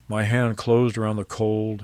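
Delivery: ordinary delivery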